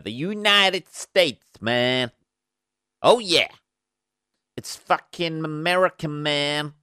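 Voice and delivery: hammed-up American drawl, high and stretched; broad mock-American twang, man; American accent. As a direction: put on an exaggerated American accent. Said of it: "American accent for anybody out there".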